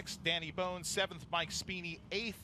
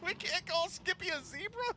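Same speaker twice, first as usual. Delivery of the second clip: pitched way up in disbelief; high squeaky voice; 13 semitones higher than usual. Real, not acted. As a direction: squeak it out, amused. Laughing disbelief at nickname.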